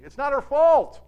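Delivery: FAULT punched high and loud; emphatic. Real, not acted. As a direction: hit "fault" hard and high, then let it fall. Emphatic point, then repeated.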